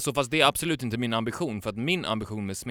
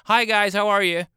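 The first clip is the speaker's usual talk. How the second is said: high, loud bubbly waiter greeting; mock a peppy server saying hi; chirpy waitress; 11 semitones higher, 8 dB louder than usual. Mimicking a Stockholm waitress's overeager greeting. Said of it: "servitris som bara".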